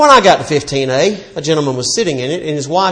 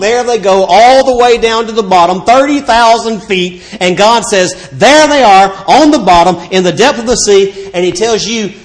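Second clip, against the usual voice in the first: louder and higher, building with excitement; preaching climax; 5 semitones higher, 4 dB louder than usual. Acted. Preacher tells the congregation God drops sins in the sea, fervent and loud. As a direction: paint the picture with rising fervor.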